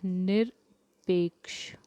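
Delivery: निरपेक्ष whispered slowly; whispered word. Shown as a demonstration. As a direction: breathe out निरपेक्ष in a slow whisper. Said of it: "निरपेक्ष किस तरह से लिखता है".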